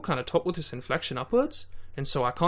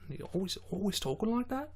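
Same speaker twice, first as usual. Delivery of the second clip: put-on soft Australian accent, pitched up with a rising end; 7 semitones higher, 6 dB quieter than usual. Showing how the beatboxer always talks, Australian accent. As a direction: imitate an Australian with high, climbing upspeak. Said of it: "That's my Australian, is basically a Tom Thumb impersonation".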